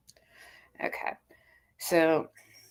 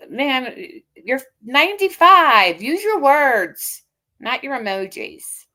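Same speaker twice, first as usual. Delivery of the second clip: scolding; 5 semitones higher, 7 dB louder, slower than usual. Real, not acted. Scolding older rival.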